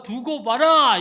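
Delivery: God's voice declaring loudly; booming declaration. Acted. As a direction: thunder it out like a promise. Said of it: "큰 소리 치며 말씀하신".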